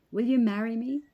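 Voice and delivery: ordinary delivery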